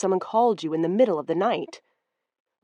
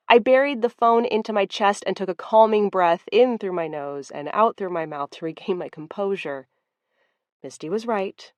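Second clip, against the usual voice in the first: usual speaking voice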